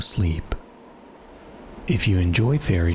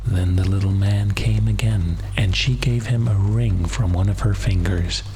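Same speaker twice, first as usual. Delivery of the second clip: bedtime story narration. Acted. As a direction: lull the listener toward sleep.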